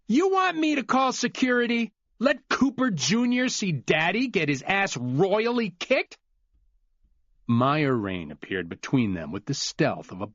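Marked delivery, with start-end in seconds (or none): 0.1-6.2 losing his temper. Acted. Glenn threatening to call security, high and furious. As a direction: threaten him at the top of your voice. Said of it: "could no longer keep his voice quiet or calm".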